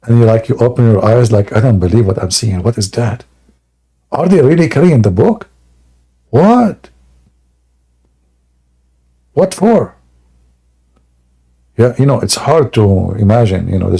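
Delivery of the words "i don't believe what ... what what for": astonished. Pretend. Imagined waking to ants.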